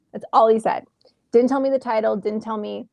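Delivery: ordinary talk, nothing marked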